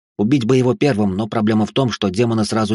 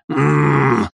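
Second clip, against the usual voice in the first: raised, closed-mouth groan of pain; pained moan; 5 semitones higher than usual. A narrator's reading. Wounded demonologist with pierced belly, moaning in pain. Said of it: "замычал он явно с пробитым животом".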